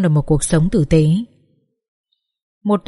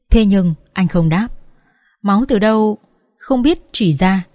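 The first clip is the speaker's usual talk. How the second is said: plain reading voice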